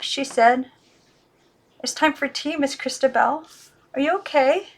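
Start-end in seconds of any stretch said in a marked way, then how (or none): 1.8-4.7 higher, gentle, worried; worried girl voice. Acted. Girl asks the doll, higher, a touch of worry.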